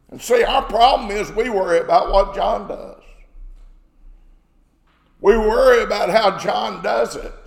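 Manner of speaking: usual speaking voice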